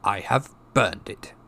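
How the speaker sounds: slow, curt uncle; short, curt statement. A narrator's reading. Uncle telling nephew the letter is burned, curt finality. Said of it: "said Uncle Vernon shortly".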